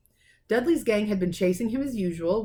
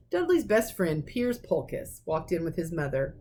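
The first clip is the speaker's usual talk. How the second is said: ordinary delivery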